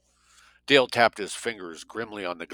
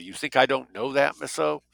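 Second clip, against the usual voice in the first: defensive retort, higher pitched; 6 semitones higher, 3 dB louder than usual. A narrator's reading. Dale answering Greta's warning, defensively. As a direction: push back with a rising, affronted question. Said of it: "Dale said defensively".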